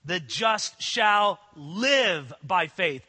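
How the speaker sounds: "live" held and weighted, slow overall; slow, lean into the word "live"; stress on live. Shown as a demonstration. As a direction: stretch "live" as the key word. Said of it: "the emphasis on live".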